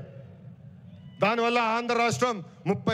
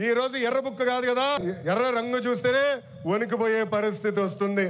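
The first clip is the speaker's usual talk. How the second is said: ordinary delivery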